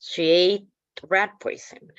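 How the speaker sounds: ordinary talk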